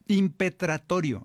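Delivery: "Impetratorio" spoken slowly and deliberately, each syllable clear; carefully sounded out. Shown as a demonstration. Priest teaching the congregation a hard word, sounding it out. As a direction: spell out "Impetratorio" by ear, syllable by syllable. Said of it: "así como se oye".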